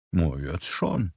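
slow, soft and silky menace; velvet-gloved threat, softly spoken; soft gentle voice. A narrator's reading. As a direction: purr it out quietly, unhurried. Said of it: "Seine Stimme ist so sanft".

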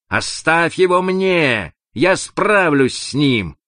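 high, emphatic shouted order; calling out. A narrator's reading. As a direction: call out firmly, drawing out the words. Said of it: "Ник крикнул ему".

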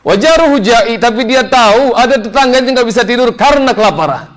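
high, ringing, emphatic sermon voice; impassioned preacher's proclamation; preaching at full force. Acted. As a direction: hammer out each repeated oath, pitched high. Preaches the Prophet's oath with force.

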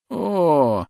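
high loud fake groan of pain. A narrator's reading. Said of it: "громко застонал он".

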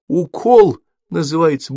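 put-on high, slow child's voice; name the ordeal like a wronged child; complaining little boy. A narrator's reading. (обиженный детский голос)

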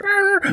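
very high yelping whine like a hurt dog; imitated dog cry. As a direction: yelp it out high, like a dog in pain. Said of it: "a dog's cry".

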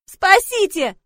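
high, loud cry for rescue; scream for help. A narrator's reading. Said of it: "закричала я". (отчаянный крик о помощи)

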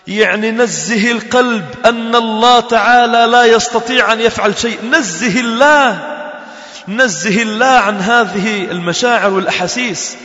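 preacher rising to a climax; full-force preaching. Acted. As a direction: drive faith into the listeners' hearts.

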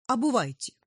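high, loud curt order; royal command. Acted. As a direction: command like a king. King commands servants.

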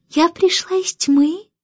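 high, slow little coo; baby cooing. A narrator's reading. Newborn beginning her story, baby cooing. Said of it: "тихо заворковала она".

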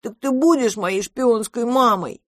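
put-on high, hopeful child voice; childish, high-pitched appeal; childlike monster. A narrator's reading. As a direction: ask like a big baby wanting a yes. Monster asks Brown again.

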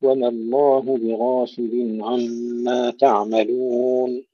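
slow drawn-out recitation, full غ and open alif in بغافل; heavy ghayn. Shown as a demonstration. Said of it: "نفخم الغين مع المحافظة". (تلاوة متأنية بتفخيم غين بغافل)

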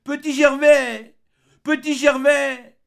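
high, slow, loud calling; crying out into the solitude; formidable desolate shout. Acted. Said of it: "une voix ... plus formidable et de plus désolé".

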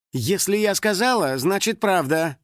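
high, emphatic shutdown; cutting. A narrator's reading. Star silencing a doubting girl, cutting. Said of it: "отрезала Мяу".

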